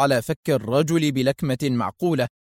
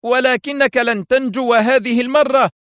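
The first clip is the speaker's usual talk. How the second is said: high, loud outburst; defiant cry; 10 semitones higher, 5 dB louder than usual. A narrator's reading. Director jolts out of shock, crying out defiantly. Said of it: "انتفض وهو يهتف".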